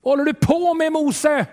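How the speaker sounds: the thirsty people crying out to Moses. Acted. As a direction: call out in protest to Moses. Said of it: "folket skriker ut".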